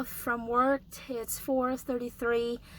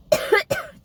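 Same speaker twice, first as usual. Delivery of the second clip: cough; 7 dB louder than usual. Real, not acted. Sick speaker coughs mid-sentence.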